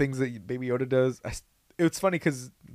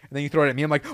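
ordinary delivery

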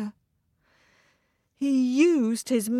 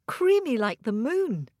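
ordinary delivery, nothing marked

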